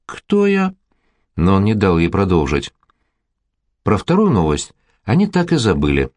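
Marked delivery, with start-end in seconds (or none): 0.1-0.8 high, indignant "who, me?"; playful shocked squeak; mock outrage. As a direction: pretend to be scandalised. Mock-offended at being called pervert.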